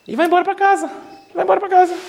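hammed-up high voice, amused; mock-casual. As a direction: tease them for just going home. Mocking disciples leaving the tomb.

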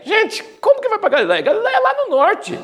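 amused high-pitched disbelief; incredulous. Real, not acted. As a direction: sound genuinely baffled at the geography. Incredulous aside about Galileia.

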